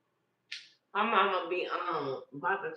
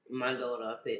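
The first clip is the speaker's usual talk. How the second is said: ordinary talk, nothing marked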